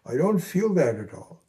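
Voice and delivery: normal talking voice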